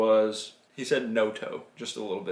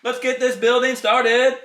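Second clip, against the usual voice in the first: 1 excited kickoff; 12 semitones higher, 8 dB louder than usual. Real, not acted. Dad hypes start of build.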